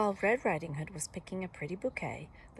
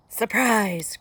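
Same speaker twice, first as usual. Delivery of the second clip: loud, triumphant burst; crying out; 3 semitones higher, 12 dB louder than usual. A narrator's reading. Wolf bursting in on Granny, crying out. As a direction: pounce on the word, loud. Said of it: "cried the wolf".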